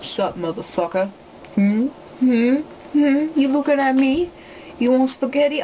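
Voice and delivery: hammed-up gangster challenge, in short questions; mock gangster. Vlogger showing viewers her gangster self, in a mock-tough voice. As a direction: play a badly faked tough gangster. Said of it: "Did you like the gangster me?".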